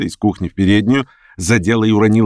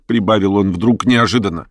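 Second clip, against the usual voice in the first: plain reading voice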